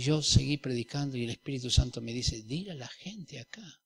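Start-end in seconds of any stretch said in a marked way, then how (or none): 2.4-3.8 soft whisper of the Spirit; gentle whisper from God; whispered divine voice. Retold or imitated. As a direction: murmur it as an inner prompting. Voicing the Holy Spirit.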